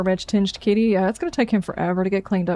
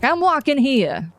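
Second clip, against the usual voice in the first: put-on amateur Jersey accent, drawn out and pitched up; 4 semitones higher, slower than usual. Streamer mimics the poor robot he bumped, hammed-up Jersey accent. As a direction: play an indignant robot in a bad Jersey accent. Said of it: "my attempt at a Jersey accent".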